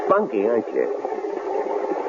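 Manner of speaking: slow, soft, sneering; sly leering remark. Acted. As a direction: savor the word like a threat. Killer sizes her up.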